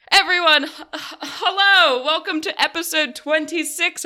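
loud, high, bubbly hello; excited greeting. Real, not acted. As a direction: burst into the welcome with a laugh. Host kicks off the episode.

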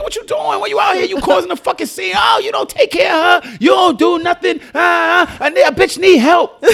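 high mock yell of the baby mother; shrill-pitched imitation of her shouting in the street; hammed-up screaming rant; retold screaming. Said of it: "screaming on me in the middle of the street".

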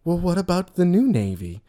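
high princess voice, imitated; lovely Belle. Belle looks at the boy and answers him, put-on lovely. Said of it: "being all lovely or whatever".

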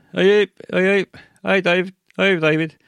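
high, loud neighbourly hail; calling out. Acted. Neighbour walking past calls to [name], calling out. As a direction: hail someone passing, pitched right up.

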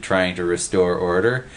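usual speaking voice

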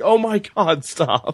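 mock exasperation. Mock plea interrupting the tirade.

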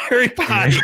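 high squealing laugh through the words; shrieking with laughter. Real, not acted. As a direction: squeal it out, cracking up. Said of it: "laughing shout of own joke".

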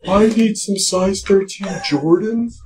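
high lady voice over a deep one; man doing a woman's voice. Retold or imitated. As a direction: play a big-voiced woman calling a store. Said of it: "with the deep voice, but talk like a lady".

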